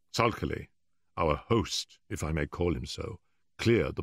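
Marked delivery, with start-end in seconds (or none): none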